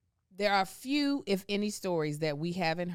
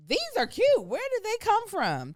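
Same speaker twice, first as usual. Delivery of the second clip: much higher, bright mock gush; gushing eye doctor; 5 dB louder than usual. Eye doctor admiring the kid's glasses, high mock gush. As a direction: imitate the impressed eye doctor. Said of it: "they were like".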